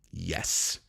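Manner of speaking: low, soft, grave; ominous mock yes. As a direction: say it like a dark secret. Mock tiny fighter answer.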